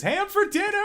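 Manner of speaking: squeaky high cheer, arm in the air; gleeful cheer. Acted. NPC cheering about ham.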